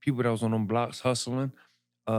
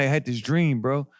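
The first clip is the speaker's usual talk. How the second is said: normal talking voice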